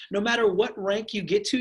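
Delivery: normal talking voice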